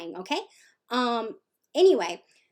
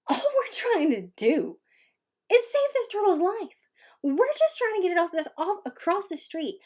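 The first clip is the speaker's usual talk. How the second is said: high-pitched, pleading exasperation; exasperated; 9 semitones higher than usual. Retold or imitated. Rescuers trying to save an ungrateful turtle, exasperated. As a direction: protest at rising pitch, at wit's end.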